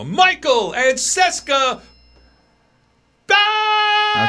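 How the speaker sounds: booming, high, drawn-out ring announcer call; fight announcer. Shown as a demonstration. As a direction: belt out the names like a boxing announcer. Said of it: "I sounded like a fight announcer".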